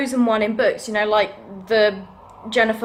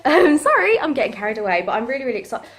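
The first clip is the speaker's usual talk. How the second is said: ordinary talk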